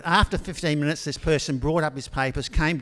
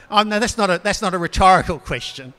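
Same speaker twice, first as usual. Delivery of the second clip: laughing aside; 4 semitones higher, 7 dB louder than usual. Real, not acted. Preacher laughs at own line.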